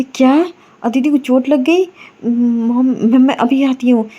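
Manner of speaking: jolted by bad news, rushing to act; shocked. Myra learns Aditi is injured and reacts in shock.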